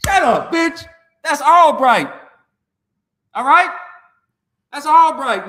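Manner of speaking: loud, high angry retort; angry shout. Real, not acted. Vlogger shouting down fans who compare Albright, loud and angry.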